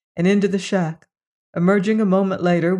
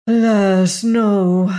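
sighing; 3 semitones higher, 6 dB louder, much slower than usual. A narrator's reading. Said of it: "sighed the native".

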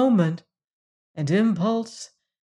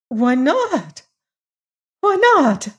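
very high wailed question, rising in force; plaintive protest; 9 semitones higher, 4 dB louder, slower than usual. A narrator's reading. Lady whose gift is refused calls after Hagar, high plaintive cry. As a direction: cry it out, higher the second time.

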